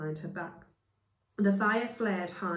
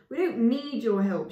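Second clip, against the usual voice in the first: spat; 4 semitones higher, 8 dB louder than usual. Acted. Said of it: "she spat".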